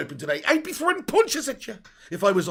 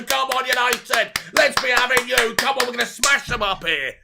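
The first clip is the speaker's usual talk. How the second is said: rallying cheer; 5 semitones higher, 7 dB louder than usual. Real, not acted. Chanting the team on.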